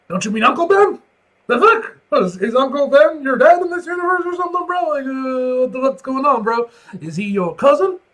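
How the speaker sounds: high, loud disbelief; baffled outburst. Real, not acted. Reader shouts in disbelief at a strange family tie. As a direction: yell in confusion at the page.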